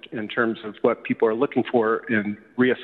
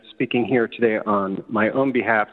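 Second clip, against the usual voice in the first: usual speaking voice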